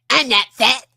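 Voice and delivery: loud, high cartoon-brat voice; Cartman. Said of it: "an entire video in that voice".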